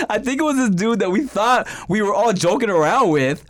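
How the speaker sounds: ordinary talk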